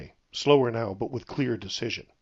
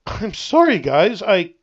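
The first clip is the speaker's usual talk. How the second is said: wincing, out of breath; pained panting; 6 semitones higher, 9 dB louder, slower than usual. A narrator's reading. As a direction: gasp it out in pain. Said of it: "Rain winced and panted".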